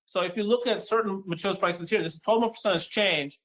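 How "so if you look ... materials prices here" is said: usual speaking voice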